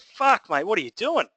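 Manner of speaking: mock disbelief, pitched well up; incredulous plasterer. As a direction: imitate a tradie appalled at the job. Retold plasterer objecting to the work.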